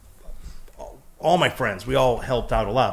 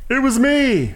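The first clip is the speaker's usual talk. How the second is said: high-pitched proud outburst; joke-brag it in a jumped-up high voice; mock boast; 14 semitones higher, 3 dB louder, slower than usual. Mocking himself as sole inventor, mock boast.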